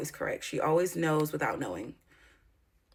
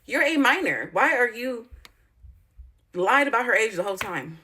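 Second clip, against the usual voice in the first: louder and higher, outraged disbelief; indignant outburst; 5 semitones higher, 5 dB louder than usual. Real, not acted. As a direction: fire it off like you can't believe it. Outraged about girl's age.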